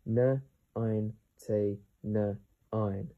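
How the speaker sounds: "N ein T N ein" in slow, isolated sounds; sounded out slowly. Shown as a demonstration. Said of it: "How to pronounce this word?".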